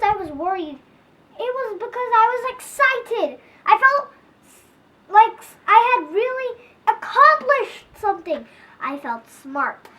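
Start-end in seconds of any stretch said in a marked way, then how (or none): 1.4-8.5 excited. Acted. Narrator's excitement rising.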